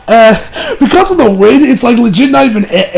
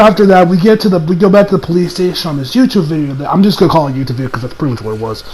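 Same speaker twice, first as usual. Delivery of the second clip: ordinary talk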